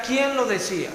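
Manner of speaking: ordinary talk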